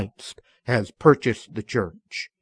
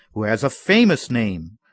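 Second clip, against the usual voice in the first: ordinary talk, nothing marked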